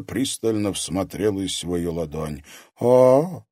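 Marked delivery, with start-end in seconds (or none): 2.8-3.4 surprised exclamation, a bit higher; taken aback by the palm. A narrator's reading. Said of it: "удивлённо воскликнула она".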